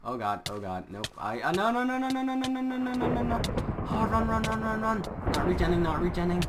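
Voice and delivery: high, loud panicked yelling; panicking gamer. Real, not acted. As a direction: scream no in real panic. Fleeing near death.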